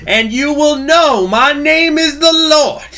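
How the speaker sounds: high, drawn-out full-force proclamation; booming mock-vengeance shout. Streamer crowns a speech on executing traitors, booming shout. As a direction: bellow it slowly like a judgement. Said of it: "But in a Russian accent".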